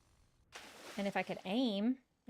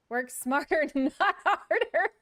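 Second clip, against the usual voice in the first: laughing correction; 6 semitones higher, 6 dB louder, slower than usual. Real, not acted.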